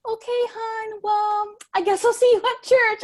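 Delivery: high, syrupy church-lady voice imitating Susie; sweet Susie voice. Susie brushing off the rejection, high sugary voice. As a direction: chirp it back like an overly sweet church friend. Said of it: "And she was like".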